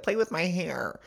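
lightly voiced toddler asking for a favour. Co-host retelling a friend's request for hair play, as a toddler. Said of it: "like a toddler and said".

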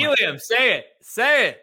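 high, insistent goading; egging on. Real, not acted. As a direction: push him hard to say it. Goading co-host to name his pick.

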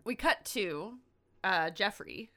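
normal talking voice

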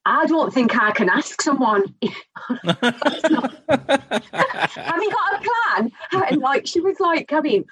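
mock Scottish slipping into northern English; northern accent for Scottish. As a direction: retell her hesitation in a badly faked Scottish voice. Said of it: "did it in a northern accent and not my Scottish".

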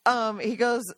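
ordinary talk

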